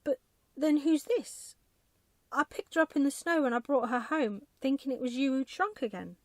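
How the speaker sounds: put-on loud, high, flustered old man; rattled husband talking fast and high; relieved, bewildered husband. A narrator's reading. Husband's voice in story.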